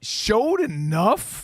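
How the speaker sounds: showed enough drawn out slowly with a rising questioning tune; stressed slow questioning. Shown as a demonstration. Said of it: "Merit the nod".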